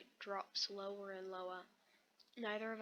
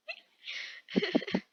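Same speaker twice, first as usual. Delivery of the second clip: loud, high burst of laughter; real laugh; 11 semitones higher, 13 dB louder than usual. Real, not acted. Reader laughs at the view count, loud high cackle.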